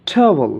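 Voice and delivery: "ট্রাভেল" opening with a puffed t; breath burst on the t of "ট্রাভেল"; aspirated T. Shown as a demonstration. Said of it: "যেমন". ("ট্রাভেল" মহাপ্রাণ ঠ দিয়ে শুরু)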